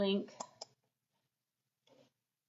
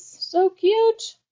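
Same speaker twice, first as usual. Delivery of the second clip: squealing excited; 13 semitones higher, 6 dB louder than usual. Real, not acted. Gushing over the bee charms.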